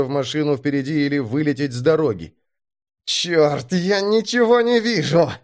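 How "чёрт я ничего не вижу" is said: tense, high-pitched hiss; hissed curse. A narrator's reading. Driver blinded by fog hisses to himself, tense. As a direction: hiss it through rising panic. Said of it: "прошипел он".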